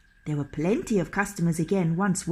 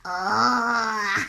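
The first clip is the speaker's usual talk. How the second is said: trolls crying out. A narrator's reading. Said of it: "cried the trolls".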